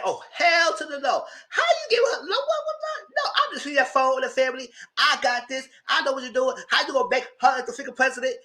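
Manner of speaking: high-pitched indignant rant, peaking in a squeal on what; hammed-up sputtering fury; mock outrage. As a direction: play the offended CFO, voice way up. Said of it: "Dana was hot".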